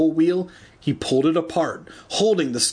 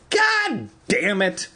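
drawn-out, high-pitched curse; crying out; 8 semitones higher, slower than usual. A narrator's reading. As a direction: cry it out slowly in frustration. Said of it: "Evan cried".

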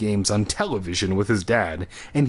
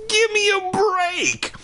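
much higher, slow angry shout; angry cry; slower than usual. A narrator's reading. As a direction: yell it in outrage. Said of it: "I cried angrily".

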